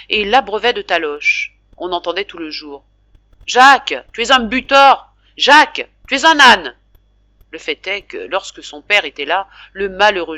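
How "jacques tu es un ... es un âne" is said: put-on loud, high angry shouts at the boy; angry scolding. Father yelling insults at clumsy son Jacques, furious shout. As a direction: bellow the insults at him.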